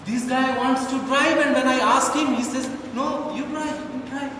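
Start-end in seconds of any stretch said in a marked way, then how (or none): 2.9-4.3 mock George. George voiced softly.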